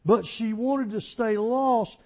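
loud, high, driving pulpit voice; fiery preaching. Acted. Preacher to church, loud fiery sermon delivery. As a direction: preach it hard to the congregation.

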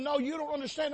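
ordinary talk, nothing marked

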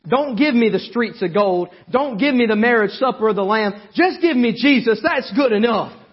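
fiery preaching. Acted. Preacher shouting to the congregation about Jesus. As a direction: preach it out full force to the church.

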